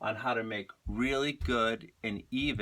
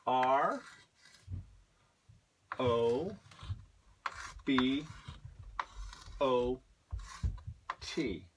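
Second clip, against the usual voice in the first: spelling out slowly; 5 dB quieter, much slower than usual. Shown as a demonstration.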